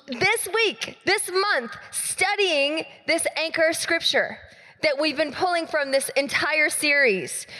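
ordinary talk, nothing marked

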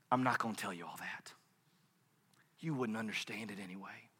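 very soft, low voice of God; calm, firm refusal. Acted. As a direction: decline gently, without arguing.

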